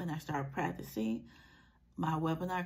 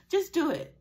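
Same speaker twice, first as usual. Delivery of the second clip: emphatic; 10 semitones higher, 7 dB louder than usual. Real, not acted. Motivational punchline after excuses.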